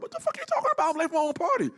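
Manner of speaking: high, loud incredulous outburst; hammed-up offended rant; mock indignant. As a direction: act outraged at the accusation. Arguing with Larry on stage.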